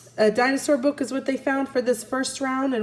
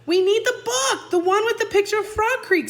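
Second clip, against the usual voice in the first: put-on higher, faster urgent boy; mildly voiced frantic boy; urgent Jack; 11 semitones higher than usual. A narrator's reading. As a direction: rush it out in a boy's voice. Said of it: "said Jack".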